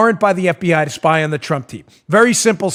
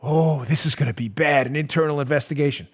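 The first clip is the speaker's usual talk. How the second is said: mock dread; 6 semitones lower, 7 dB quieter than usual.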